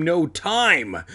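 rising, insistent outburst; declared urgently. A narrator's reading. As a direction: declare it, punching TIME high. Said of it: "I declared".

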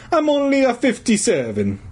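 high-pitched cartoon Italian accent; offended old Italian janitor. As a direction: correct them in hammy Italian. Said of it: "in his hoarse Italian voice".

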